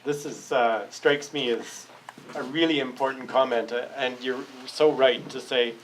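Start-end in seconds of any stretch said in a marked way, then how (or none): none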